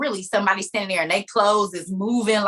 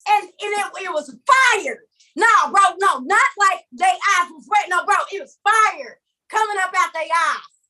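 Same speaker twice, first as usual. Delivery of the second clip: higher, emphatic protest; insistent retelling; 6 semitones higher than usual. Acted. Dreamer to a bro who is not listening, insistent. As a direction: correct a doubting listener urgently. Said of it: "That's what I would have".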